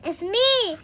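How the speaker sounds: Mister Happy calling. A narrator's reading. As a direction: call through the door. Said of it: "called out".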